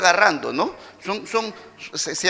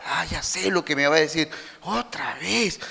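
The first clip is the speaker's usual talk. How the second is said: muttered thought; 4 semitones lower, 6 dB quieter than usual. Retold or imitated. Pensamiento fastidiado del hijo.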